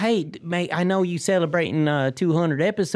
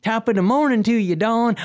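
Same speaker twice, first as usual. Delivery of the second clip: cheery stage Irish, pitched up a little; Irish accent; 3 semitones higher than usual. Shown as a demonstration. Host showing co-hosts their Irish accent on request, hammed-up Irish. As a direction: attempt a hammed-up Irish greeting. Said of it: "Try it again".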